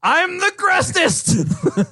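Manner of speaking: very high, drawn-out victory yell; over-the-top gloating shout; mock triumphant cry. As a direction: crow it out slowly, way up high. Mock boast after tiebreaker news.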